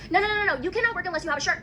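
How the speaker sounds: high-pitched scolding, no added volume; retold scream. Worker recounts being refused work without a shirt, mock scream. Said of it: "she starts screaming at me".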